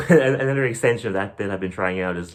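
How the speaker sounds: ordinary talk, nothing marked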